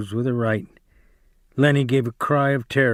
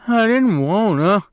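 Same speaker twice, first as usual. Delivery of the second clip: high, louder tearful wail; crying; 8 semitones higher, 4 dB louder than usual. Acted. Lennie to Slim and George, high tearful wail. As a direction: plead through tears. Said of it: "Lenny cried".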